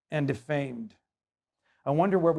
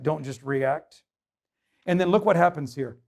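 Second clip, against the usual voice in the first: ordinary talk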